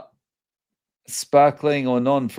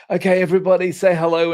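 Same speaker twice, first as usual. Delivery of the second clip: bright lift, higher and louder, to an audience; retold livestream welcome, pitched up and loud; upbeat host greeting; 5 semitones higher, 4 dB louder, faster than usual. Host to everybody coming in live, upbeat greeting.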